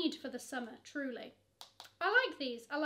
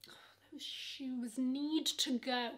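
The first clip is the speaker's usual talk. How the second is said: slow hushed whisper; slow whispered aside; 2 semitones lower, slower than usual. Real, not acted. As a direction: whisper it like a secret verdict. Whispered aside about ugly shoes.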